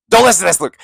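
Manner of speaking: loud, high blurted warning; urgent mock warning. Real, not acted. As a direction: call out a hasty warning to a friend. Mock warning after spoiling it.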